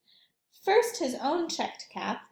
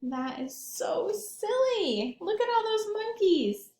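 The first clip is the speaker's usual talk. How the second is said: delighted amusement; 8 semitones higher than usual. Acted. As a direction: marvel at the silly monkeys for the kids. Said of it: "delighted aside to children".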